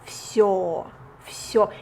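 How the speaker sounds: всё with ё said close to o; ё sounds like о. Shown as a demonstration. Teacher modelling всё for learners, deliberately. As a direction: repeat всё slowly, ending in yo. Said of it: "sounds a little bit like о".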